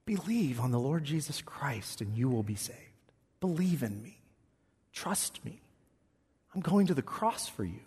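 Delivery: soft whispered voice of Jesus; barely above a whisper, tender and inviting; hushed gentle Jesus. Retold or imitated.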